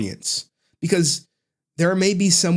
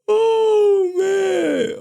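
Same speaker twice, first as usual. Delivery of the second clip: high excited yell; excited shout; 4 dB louder than usual. Real, not acted. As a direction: cry out with delight. Reacting to clip editing.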